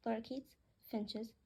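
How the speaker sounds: ordinary delivery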